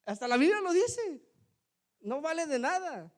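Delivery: voz alta y muy aguda de prédica; exclamación de predicador. Real, not acted. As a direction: exclama con énfasis desde el púlpito. Preacher exclaims against empty talk. (exclamación enfática)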